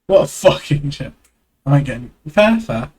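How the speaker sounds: usual speaking voice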